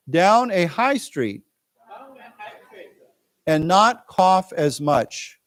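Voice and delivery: slow, deliberate reading for learners; teacher reading a news text to students; slow read-aloud. Acted. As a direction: read it out slowly with long pauses. Reads article slowly aloud.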